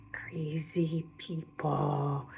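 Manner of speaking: hushed, slow ominous whisper. Acted. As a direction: lean in and whisper it darkly. Ominous aside about crazy locals.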